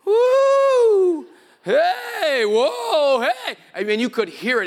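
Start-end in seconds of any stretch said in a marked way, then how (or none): 0.0-3.6 high, loud whoops building into yells; wild freak-out hollering; engine-revving freak-out. Retold or imitated. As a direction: wind up with a long woo, then yell out. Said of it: "like an engine revving".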